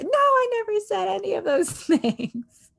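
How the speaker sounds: high mock wail, cutting in; hammed-up high protest, breaking into a laugh; retold indignant denial. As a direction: imitate an outraged mother denying everything. Retold mother interrupting with denial.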